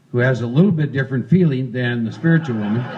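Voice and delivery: usual speaking voice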